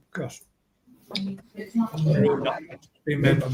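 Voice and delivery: eating while talking; talking through a mouthful; mouth full. Real, not acted. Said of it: "guys, I'm starving, so I'm gonna eat it".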